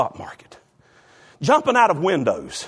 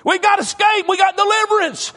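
high, eager cry of freedom; excited prisoner; 13 semitones higher, 3 dB louder than usual. Retold or imitated. Freed prisoners announcing escape, much higher excited cry. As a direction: call it out like the doors just opened. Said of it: "some of them prisoners say".

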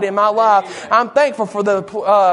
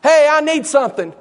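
hollered "hey", pitched up; crying out; 5 semitones higher than usual. Acted. Beggar to Peter and John as they walked by, hollering. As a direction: holler after people walking past. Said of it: "He cried to Peter and John".

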